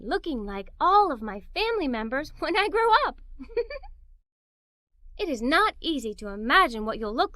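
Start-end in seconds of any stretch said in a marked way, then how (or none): 3.3-3.9 short light giggle. Acted. Narrator giggling at the picture of growing up. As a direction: let a giggle slip out. Imagining looking like family.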